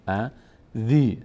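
"ا، ذی" split, with dhee held long; mistaken long ee. Retold or imitated. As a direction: show the error, stretching "ذی" as dhee. (ذیِ کشیده و اشتباه)

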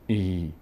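"ای" drawn out slowly into a long ee; the wrong way, stretching the vowel; slow demonstration of the overlong "ای" to avoid. Shown as a demonstration.

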